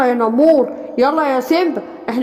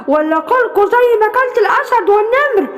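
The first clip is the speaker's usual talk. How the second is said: threatening shout; 7 semitones higher than usual. Acted. Bear threatens the crying cubs.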